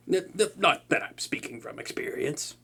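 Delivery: stammered whispered denial; flustered whisper. Acted. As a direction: whisper it, caught out. Embarrassed denial aside.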